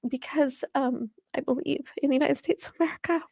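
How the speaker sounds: tearful, halting, voice lifting with real emotion; emotional. Real, not acted. Activist explaining why she stays in her state, tearfully emotional. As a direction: struggle through tears, stopping to recover. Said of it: "I get so dang emotional".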